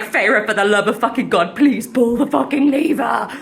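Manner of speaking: exasperated shouted plea. Acted. Lucien begging Feyre to pull the lever, shouted desperately. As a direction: plead at full volume for action.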